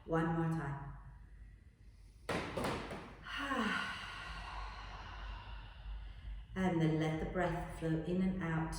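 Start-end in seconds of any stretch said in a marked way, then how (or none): 2.2-6.5 long breathy sigh out; dramatic sigh. Shown as a demonstration. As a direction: let it all out in a big sigh. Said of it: "and a big sigh".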